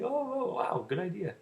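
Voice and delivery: high, drawn-out "oh" of mild wonder; mock impressed reaction. Imagined listener reacting to a good story, impressed.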